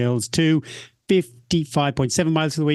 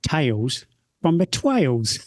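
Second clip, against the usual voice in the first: slow, put-on southern English accent, a bit Australian; slower than usual. Shown as a demonstration. Host trying a southern English accent for the segment title. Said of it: "should I try and do a southern accent".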